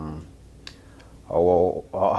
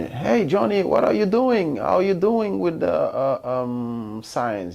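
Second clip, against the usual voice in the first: imitating teacher; 11 semitones higher than usual. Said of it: "And he asked me".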